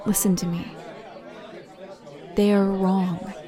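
soft, gentle reassurance; tender comfort. Acted. Comforting the hurt partner after the bully leaves, softly. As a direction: hold them close with the voice.

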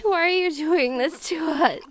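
ordinary delivery

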